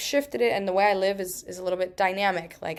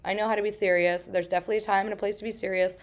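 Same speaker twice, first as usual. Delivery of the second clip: ordinary talk, nothing marked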